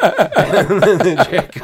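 slow and loud through laughter; laughter dragging the words out; laughing. Real, not acted. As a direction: still cracking up. Still laughing.

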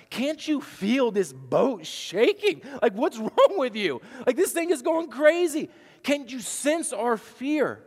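high, loud, frantic pleading with a nervous laugh; panicked disciples. Retold or imitated. Preacher voicing disciples shouting at Jesus in panic. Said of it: "and they say".

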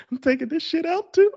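high, laughing line at his own joke. Real, not acted. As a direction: laugh it out, half giggling. Laughing over own remark.

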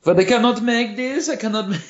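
high put-on German tourist voice; German tourist protesting in a put-on accent; mock German accent. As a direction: whine it like a stubborn German tourist.

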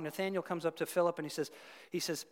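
ordinary delivery

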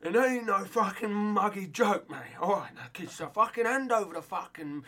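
aggressive cop voice. Retold or imitated. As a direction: ham up a swaggering dodgy policeman shaking down kids. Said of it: "This is Fabian in a police outfit".

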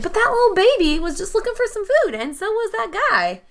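high-pitched pleading in the raider's defence; pleading protest. Acted. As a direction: plead their case, voice pitched right up. Pleads for the raiders she pities.

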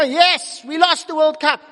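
loud, high cheer played for absurdity; mock victorious shout. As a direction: celebrate a defeat as if winning. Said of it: "shouting victorious when".

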